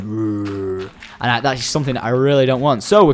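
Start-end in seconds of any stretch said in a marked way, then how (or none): 0.0-0.9 drawn-out low "rrrr"; imitated mob growl. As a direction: imitate a mob's rumbling noise. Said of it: "I hear 'em going".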